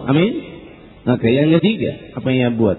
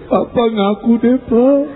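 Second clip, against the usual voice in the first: raised, mock-crying wife's voice; tearful pleading; 9 semitones higher, slower than usual. Crying wife urges husband to confess, tearful pleading. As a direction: plead with a put-on sob. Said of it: "Istrinya ada nangis".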